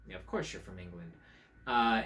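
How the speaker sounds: ordinary delivery, nothing marked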